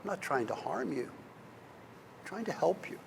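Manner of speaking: soft, quiet reassurance; calm him gently but with weight; powerful yet tender. Retold or imitated. Gandalf reassuring Bilbo, softly tender. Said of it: "in a powerful and yet tender way".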